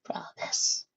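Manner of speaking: hoarse, breathless whisper; raspy whisper. Acted. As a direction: rasp it with dying breath. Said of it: "in a raspy voice".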